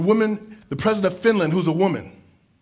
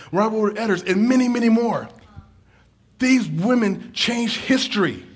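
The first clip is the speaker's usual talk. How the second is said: fiery preaching; 5 semitones higher, 3 dB louder than usual. Acted. Preaching at full force.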